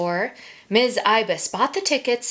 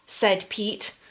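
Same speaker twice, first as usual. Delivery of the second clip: usual speaking voice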